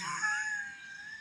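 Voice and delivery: very high, squealing laugh; cracking-up shriek. Real, not acted. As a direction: laugh helplessly. Laughs at the story.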